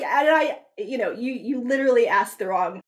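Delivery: normal talking voice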